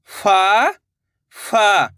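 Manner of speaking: each फा long and separate; syllable by syllable. Shown as a demonstration. Teacher showing learners each letter, syllable by syllable. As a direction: sound out फा, then फा. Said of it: "एक अक्षर का उच्चारण करके".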